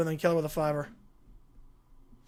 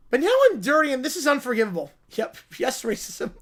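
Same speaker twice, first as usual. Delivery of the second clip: ordinary talk, nothing marked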